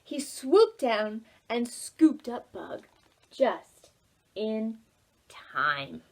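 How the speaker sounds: usual speaking voice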